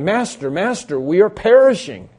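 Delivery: high, loud frightened plea; panicked cry. Acted. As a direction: cry out to the sleeping master in panic.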